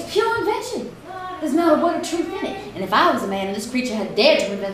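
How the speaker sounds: high-pitched, heated denial; indignant protest. Acted. Wife defending her sister against husband's stories, indignant protest.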